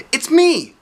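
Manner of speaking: high, loud cheerful call. A narrator's reading. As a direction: call out brightly through the door. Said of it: "called out Mister Happy".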